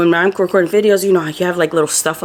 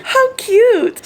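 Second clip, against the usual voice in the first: delighted squeal. Real, not acted. Admiring the garland.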